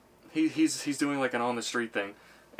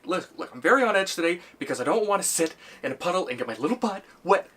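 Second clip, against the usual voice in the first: higher, louder jittery voice; nervous guy; 7 semitones higher, 5 dB louder than usual. Retold or imitated. Tough guy explains his fear of puddles, jittery high voice. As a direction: gabble it nervously. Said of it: "and he's like".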